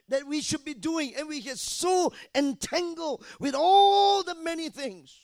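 high, loud shouted sermon; fiery preaching. Acted. Preacher exhorting the congregation, loud high shouting. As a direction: preach it with full force.

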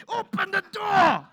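high, very loud furious yell; a father shouting at the kids behind the door; Ezra's shout. Retold or imitated. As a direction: roar it like a dad losing patience. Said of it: "I'm trying to sound like Ezra".

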